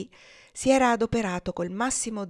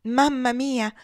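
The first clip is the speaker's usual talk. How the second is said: high, louder cry of delight; great joy; 7 semitones higher, 5 dB louder, much slower than usual. A narrator's reading. Evangelina embraces her mother repeatedly, overjoyed. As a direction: exclaim it with happy affection. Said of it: "esclamò Evangelina con gran letizia".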